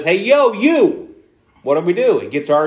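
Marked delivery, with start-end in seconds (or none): 0.0-1.1 high street call for attention. Shown as a demonstration. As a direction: grab someone's attention. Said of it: "someone says".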